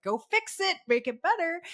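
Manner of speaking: put-on high demanding child voice; bossy kid. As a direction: order it like a pushy kid. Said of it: "They're like".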